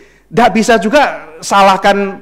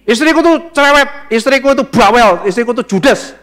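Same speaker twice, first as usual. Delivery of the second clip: high, emphatic list of grievances; mock complaining husband; 6 semitones higher, 3 dB louder than usual. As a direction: play an exasperated husband griping. Socrates complaining about his wife. (tiruan suami mengeluh, nada tinggi)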